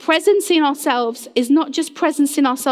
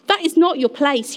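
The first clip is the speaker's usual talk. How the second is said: ordinary talk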